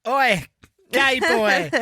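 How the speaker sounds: high, loud heckle; playfully confrontational shout; mock calling out. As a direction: holler it at someone across the way. Mock calling out Carson to confront him.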